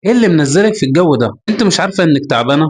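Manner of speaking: ordinary delivery, nothing marked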